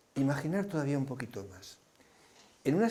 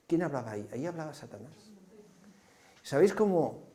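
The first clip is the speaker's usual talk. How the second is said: ordinary delivery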